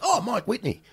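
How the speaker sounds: high, starstruck naming; light imitation of an excited fan; recognizing fan.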